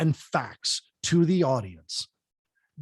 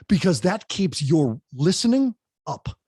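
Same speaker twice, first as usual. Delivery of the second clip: usual speaking voice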